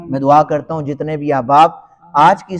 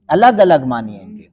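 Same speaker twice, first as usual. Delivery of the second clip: ordinary talk, nothing marked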